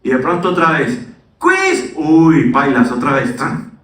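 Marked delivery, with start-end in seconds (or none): 1.4-1.9 very high squeaky teacher call; mock teacher. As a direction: yelp it like a gleeful teacher. Teacher springs surprise quiz.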